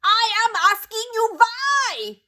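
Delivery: high, slow, loud witch scream; Grand High Witch screaming at silent witches. A narrator's reading. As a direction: scream the demand at the hall. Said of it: "she screamed".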